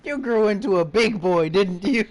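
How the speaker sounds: high, loud cooing granny; teasing grandma voice. Acted. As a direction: tease like a doting grandma. Grandma ghost teasing again.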